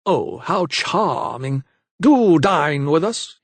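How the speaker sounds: high, slow, hammed-up society lady; mocking mimicry. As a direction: ham up her simpering invite. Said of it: "he mimicked".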